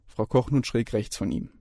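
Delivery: ordinary delivery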